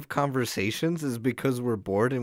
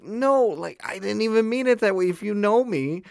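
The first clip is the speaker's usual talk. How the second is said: high-pitched retold protest; exasperated self-defense; 9 semitones higher, 3 dB louder than usual. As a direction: plead your case, pitch climbing well up. Retells defending himself to upset girl.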